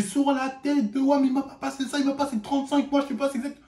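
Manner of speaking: high, pleading protest voice; imitating a panicked kid swearing he paid; protesting boy. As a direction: squeak out his innocence, mildly mocking.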